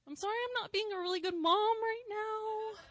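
loud, high, tearful cry; tearful apology. Retold or imitated. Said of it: "And I'm like".

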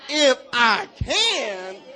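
loud, high, long-stretched sass; with attitude. Shown as a demonstration. Preacher spurs on an eager congregation, with attitude. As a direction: belt it out slowly with full attitude. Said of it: "Point to the ground with attitude".

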